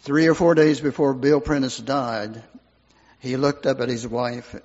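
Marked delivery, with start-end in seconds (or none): none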